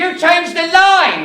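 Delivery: outraged shout. Real, not acted. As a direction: explode at the actor for changing it. Writer outraged at the actor.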